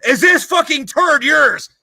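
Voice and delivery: high, loud, slow accusing question; drill instructor yell. Retold or imitated. Drill instructor confronting the next recruit, yelling. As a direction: demand it like a furious sergeant.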